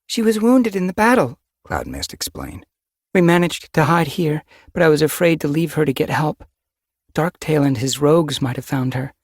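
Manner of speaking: high, bright worried she-cat; young she-cat voice. A narrator's reading. Sister cat's voice.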